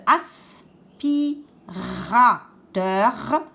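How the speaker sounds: "aspirateur" slowly split, both Rs held; syllable by syllable. Shown as a demonstration. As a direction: separate the syllables of "aspirateur" and lean on each R. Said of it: "j'entends deux R". (« aspirateur » découpé lentement en insistant sur les R)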